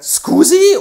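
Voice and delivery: very high, loud shocked squeak. Acted. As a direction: squeak it in disbelief. Shocked reply.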